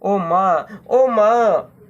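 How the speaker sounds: put-on a child bird sobbing into mother's lap; lightly acted sobbing. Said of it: "सुबकने लगी".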